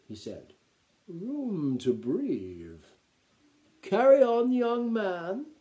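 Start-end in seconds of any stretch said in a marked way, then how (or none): 1.1-5.5 drawn-out, then loud and high; grand old-style aristocratic flourish; theatrical count. A narrator's reading. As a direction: make a show of relief. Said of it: "the Count looked around theatrically".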